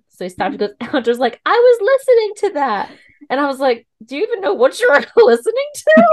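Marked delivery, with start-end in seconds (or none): 1.4-2.9 high, loud imitation of an upset kid; indignant child protest. Child protests stopped music. 4.0-6.0 high, loud disbelief, breaking into a laugh; mock-challenge the kid in a raised voice; incredulous parent. Parent's retold incredulous question to child.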